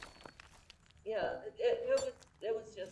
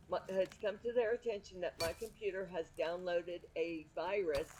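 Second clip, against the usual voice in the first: ordinary talk, nothing marked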